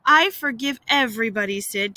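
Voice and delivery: plain reading voice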